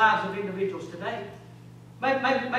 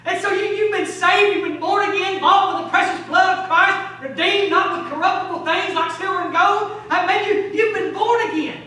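loud, high, jubilant proclamation; full-force preaching; 7 semitones higher, 9 dB louder than usual. Real, not acted. Preacher proclaiming the saved are born again, full-force preaching. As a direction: proclaim it to the rafters.

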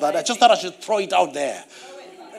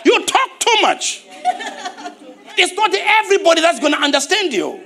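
mock scolding; 9 semitones higher than usual. Real, not acted. Scolding, mock rebuke to congregation.

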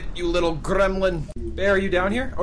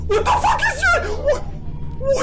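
a huge high shriek of panic through laughter; terrified high laughing shriek; shrieking terror. Real, not acted. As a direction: screech it, laughing in fright. Monster chases them.

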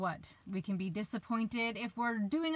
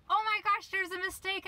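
frantic husband; 10 semitones higher, 4 dB louder than usual. Pretend. Husband voiced cancelling the trip.

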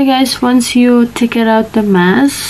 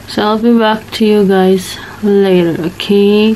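face mask limiting mouth movement; talking with stiff lips; through a sheet mask. Real, not acted. Seen 0: Wet sheet mask covers her face around the open mouth while she talks.

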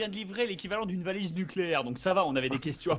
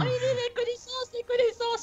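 high, slow mock clerk voice; mocking suspicious clerk. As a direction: imitate her cagey repeating. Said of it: "en disant".

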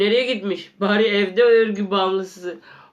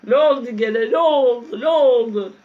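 loud, high repeated calling; 5 semitones higher, 5 dB louder than usual. Real, not acted. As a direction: call out to the character. Calling out to the character.

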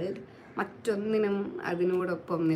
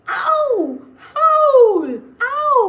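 three very high, loud yelps of pain; howl of pain; 14 dB louder, much slower than usual. Acted. Wolf burning his mouth on toffee, howling.

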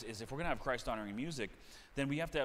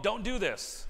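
higher, louder scolding parent; mock parent; 7 semitones higher, 5 dB louder, slower than usual. Preacher imitating a parent scolding a child. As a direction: play the nagging parent. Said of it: "When you grow up".

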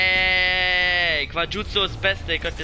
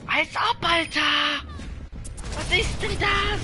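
high, startled outburst; shocked shout; 10 semitones higher, slower than usual. Real, not acted. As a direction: yell it in disbelief.

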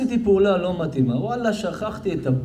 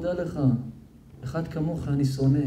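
quiet divine rebuke; 4 semitones lower, 7 dB quieter than usual. Acted. Quoting God's rebuke softly.

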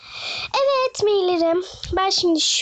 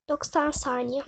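ordinary talk, nothing marked

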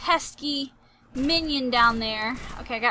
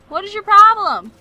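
raised, higher exasperated cry; 7 semitones higher, 12 dB louder than usual. Pretend. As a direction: yell at a pesky enemy. Yelling at game enemies, exasperated.

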